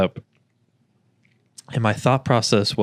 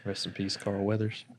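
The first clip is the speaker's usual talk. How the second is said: solemn; 12 dB quieter than usual. Acted. Solemn tribute.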